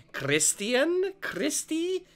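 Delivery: put-on high creepy child; creepy twin voice. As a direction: call out like a spooky kid.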